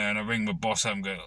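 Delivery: normal talking voice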